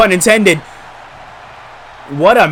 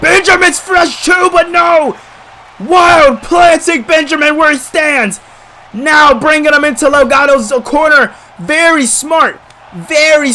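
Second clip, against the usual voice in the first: excited; 8 semitones higher than usual. Real, not acted. Hyped commentary on the finisher.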